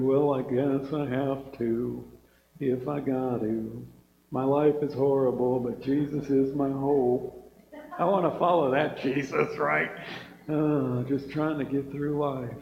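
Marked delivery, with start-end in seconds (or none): 0.0-7.5 lightly voiced mopey Eeyore. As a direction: drag it out, gloomy and defeated. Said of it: "Don't be an Eeyore".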